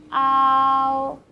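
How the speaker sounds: usual speaking voice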